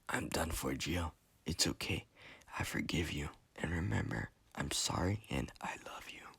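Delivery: faint, whispery last words; dying breath. A narrator's reading. Dying Enrique forgives Gio, dying breath. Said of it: "with his dying breath".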